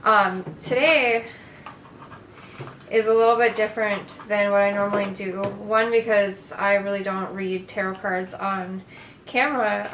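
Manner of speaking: ordinary delivery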